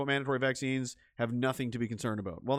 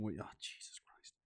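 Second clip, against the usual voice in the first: exasperated whisper; 7 semitones lower, 18 dB quieter than usual. Real, not acted. Muttering at unexpected playback.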